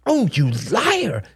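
high, drawn-out accusation; indignant mother's rebuke; angry hiss. A narrator's reading. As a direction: hiss it at the child. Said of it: "hissed Helen".

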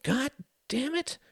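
very high, restrained inner curse; dismayed thought. Acted. Mother curses herself for embarrassing son, dismayed. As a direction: curse inwardly, pitched right up. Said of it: "she thought, drawing back".

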